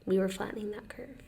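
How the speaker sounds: whispered aside. Real, not acted. Joking to her cohost in a whisper. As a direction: hush it like a secret joke.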